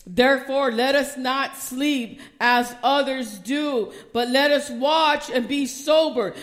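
loud, slow, preaching the verse; preaching at full force. Acted. Preacher to congregation, booming sermon delivery. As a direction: declaim it to the congregation.

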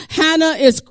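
loud, high, rising shouted sermon; full-force preaching. Real, not acted. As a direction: preach it out with fire to the church.

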